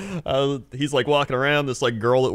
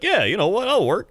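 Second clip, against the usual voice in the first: higher-pitched, lightly hammed-up willingness; eager agreement; 9 semitones higher than usual. New hire agreeing to work through liquidation, eager. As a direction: play the agreeable young hire. Acting out his eager teenage acceptance.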